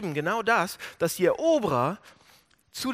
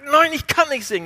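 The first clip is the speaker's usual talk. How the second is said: laut und höher, trotziges Nein; zornige Weigerung; 9 semitones higher, 11 dB louder than usual. Retold or imitated. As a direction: weise die Forderung zornig zurück. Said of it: "Er bleibt zornig". (der Psalmist verweigert den Spöttern zornig das Lied)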